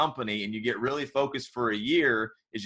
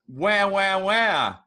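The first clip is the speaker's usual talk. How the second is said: high mock crybaby whine, louder; whiny buffoon; 8 semitones higher, 3 dB louder than usual. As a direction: whine it up high like a big baby. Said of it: "when you say it, I sound like a complete buffoon".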